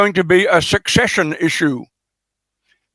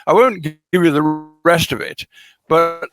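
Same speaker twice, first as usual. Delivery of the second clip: ordinary delivery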